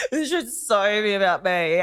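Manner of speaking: slow, laughing mock whine; laughing at herself. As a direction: giggle through the selfish line. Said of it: "I was like".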